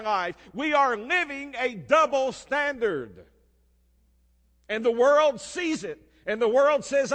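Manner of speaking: loud, high preacher voice; rebuking the churches, preaching loud; preaching at full force. Acted. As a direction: drive the point home hard. Preaching climb on truth.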